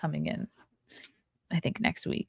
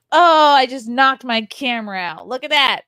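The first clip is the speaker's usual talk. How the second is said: loud, higher-pitched startled exclamation; startled outburst; 6 semitones higher, 8 dB louder than usual. Real, not acted. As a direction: blurt it out at the sudden mishap. Just knocked the camera out.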